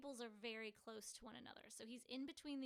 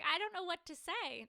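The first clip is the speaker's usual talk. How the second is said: louder, higher, flustered blurt; stunned reply; 6 semitones higher, 12 dB louder than usual. Retold or imitated. Retelling her answer to the ring, a stunned loud blurt. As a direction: blurt it out, overwhelmed by the proposal. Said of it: "I was like".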